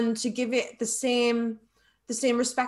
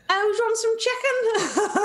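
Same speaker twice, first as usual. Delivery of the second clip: hammed-up Scouse accent, high and slow, laughing through it; a jokey, deliberately bad Scouse accent; awful Scouse accent; 11 semitones higher, 4 dB louder than usual. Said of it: "That's my awful Scouse accent".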